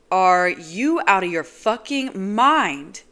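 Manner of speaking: slow, loud furious yell; angry shout. Acted. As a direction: hurl it at her. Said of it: "I cry".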